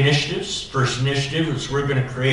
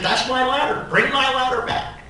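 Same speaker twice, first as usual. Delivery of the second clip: retold scream; 13 semitones higher than usual. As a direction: shout high for the ladder back. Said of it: "I started screaming at this guy".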